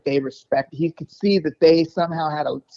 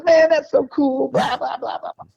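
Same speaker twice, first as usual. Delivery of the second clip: impressed guitarist; 11 semitones higher than usual. Retold or imitated. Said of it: "He goes".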